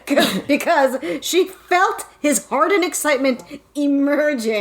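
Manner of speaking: reading through giggles; barely holding in laughter; laughing. Real, not acted. Reading steamy line aloud.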